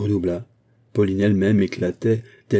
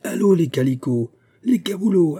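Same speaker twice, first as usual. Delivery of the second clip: rowdy river cries; 9 semitones higher than usual. A narrator's reading. Rowdy rower bellowing at the shop clerks. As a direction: call out like a drunk boatman. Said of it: "les cris de la rivière".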